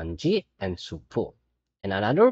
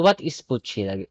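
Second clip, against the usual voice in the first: ordinary talk, nothing marked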